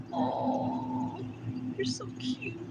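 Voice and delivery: high, hushed adoring sigh; tender cooing. Real, not acted. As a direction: melt softly over something tiny and cute. Melting over the ducks.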